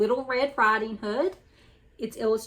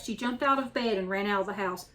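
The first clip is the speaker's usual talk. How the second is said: usual speaking voice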